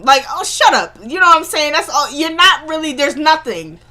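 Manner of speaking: real annoyance at Steven; exasperated. Real, not acted. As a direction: vent at the TV, irritated. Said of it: "I'm getting so tired of Steven".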